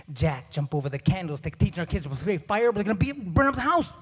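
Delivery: scandalized dad, escalating; mock alarm. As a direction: start measured, then speed up in comic panic.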